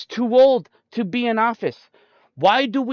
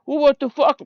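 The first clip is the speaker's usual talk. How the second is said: higher, louder mock outburst at "what the fuck"; imitating dad's rant; 6 semitones higher, 3 dB louder than usual. Dad yelling at the TV news, higher, louder mock exasperation. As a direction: play his exasperated dad ranting about politics. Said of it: "how he would sit there and say".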